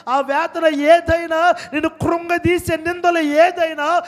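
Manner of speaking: loud, high impassioned preacher; full-force preaching. Real, not acted. Preacher pressing the congregation about their tears, full-force preaching. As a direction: hurl each question out at full voice.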